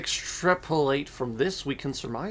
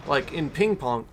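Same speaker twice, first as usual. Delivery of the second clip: ordinary talk, nothing marked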